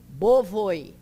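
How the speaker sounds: BOVOI ending in an oy glide; final J as I. Shown as a demonstration. Teacher shows the plural, BOVOI ending in I glide. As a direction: close BOVOI with a short I. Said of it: "a pronúncia dele é de I".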